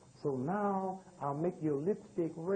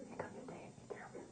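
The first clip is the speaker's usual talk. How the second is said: unvoiced hushed whisper to the kids; 15 dB quieter, faster than usual. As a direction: whisper it so dad can't hear. Mother whispering to children.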